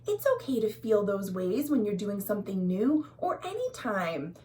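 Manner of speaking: usual speaking voice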